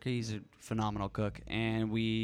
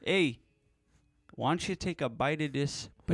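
put-on Canadian accent; 6 semitones higher, slower than usual. Neighbor urging a bite of food, joking Canadian accent. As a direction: play up the Canadian vowels for laughs. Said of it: "give me the Canadian accent".

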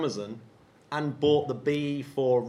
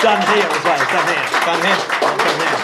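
panto shout; 3 semitones higher, 12 dB louder than usual. Acted. Panto call and response.

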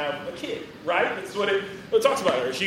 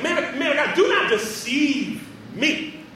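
wary plea; 3 semitones higher, slower than usual. Retold or imitated. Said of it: "she's like".

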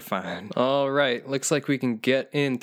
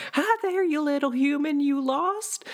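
much higher, sing-song friendly swamp creature; sweet creature greeting. Retold or imitated. Swamp creature greeting a lost little human, much higher and sing-song. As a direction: chirp it up high like a harmless monster. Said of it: "I'd walk right on up to them and say".

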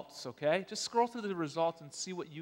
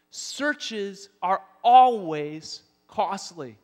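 very slow, loud, each word leaned on; slow emphatic refrain; 3 semitones higher, 9 dB louder, much slower than usual. Shown as a demonstration. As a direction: drive home the point slowly. Congregation-style refrain, slowed.

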